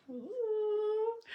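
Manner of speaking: high, loud delighted ooh; a thrilled, gleeful ooh; excited ooh. Real, not acted. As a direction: squeal with glee at what's coming. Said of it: "getting to the fun scene".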